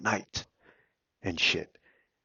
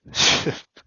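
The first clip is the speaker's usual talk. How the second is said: loud laugh; 11 dB louder than usual. Real, not acted. Mocking laugh at meditators.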